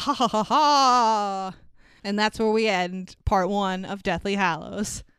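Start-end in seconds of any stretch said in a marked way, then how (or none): 0.0-1.5 slow evil villain cackle; sneering panto villain. Pretend. Villain laugh after Voldemort scene.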